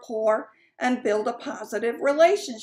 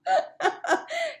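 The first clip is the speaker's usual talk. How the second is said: high laugh; 3 dB quieter than usual. Real, not acted. Laughing at hanging up.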